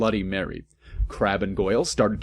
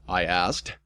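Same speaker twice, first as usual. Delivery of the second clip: ordinary delivery, nothing marked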